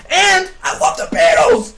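high, loud, drawn-out shout; hammed-up fanatical yell; mock crazed fan. Acting out the crazed Beatles fan.

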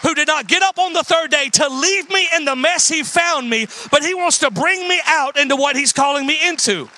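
fervent sermon delivery; preaching. Acted. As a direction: preach it up to the back row. Preaching at full force.

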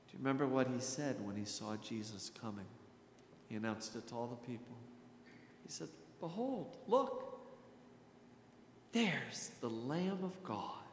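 ordinary talk, nothing marked

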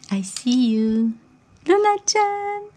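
very slow, stretched playful tease; imitating Toshiki. Mom copying dad's catchphrase to baby, very slow high tease. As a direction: drag each word out to make the baby laugh. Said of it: "Eso siempre se lo dice Toshiki".

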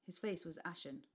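plain reading voice

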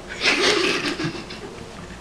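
airy wheezing laugh; breathy laugh. Real, not acted. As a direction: burst out laughing silently. Laughing at the comment.

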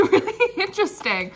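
giggly amused; laughing. Real, not acted. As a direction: laugh through the words. Laughs while saying.

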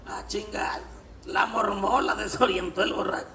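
normal talking voice

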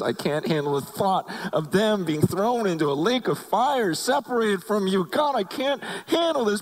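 high, strained plea to God; a tearful, high-pitched plea; pleading prayer. Retold or imitated. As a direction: beg God to save them. Desperate prayer for his friends.

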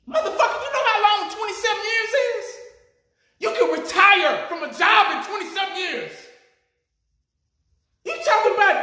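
half-laughing, can't believe what he heard; laughing outrage at a coach's quote; incredulous laughing rant. Real, not acted. Disbelieving rant at coach's words.

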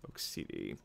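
whispered to himself, hoping. Real, not acted. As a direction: breathe the words almost silently. Hoping for driver.

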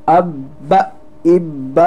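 "अब, इब" with a hard held bb; firm doubled consonant. Shown as a demonstration. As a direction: close firmly on the b in "अब, इब", then release it. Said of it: "थोड़ी सी सख्ती इसमें करनी है".

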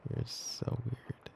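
slow, drawn-out whisper. Acted. Girlfriend fondly teasing her partner for calling her kitty, slow whisper. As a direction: breathe it out slowly, fondly teasing.

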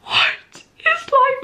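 very high, drawn-out squeal; overwhelmed disbelief. Real, not acted. Fan stunned by the giant spell book, squealing. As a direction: squeal it slowly, pitch way up.

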